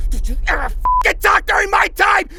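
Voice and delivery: ordinary talk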